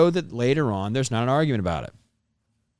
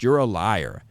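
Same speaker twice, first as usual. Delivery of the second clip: ordinary talk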